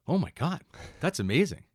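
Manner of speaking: high-pitched imitated gushing; lightly hammed-up onlooker awe; mock amazement. Passers-by watching a tiny girl on a scooter, mock amazement.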